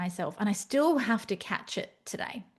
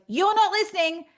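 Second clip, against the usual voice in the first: fed-up boulder; 8 semitones higher than usual. Retold or imitated. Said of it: "a giant boulder flattens you and goes".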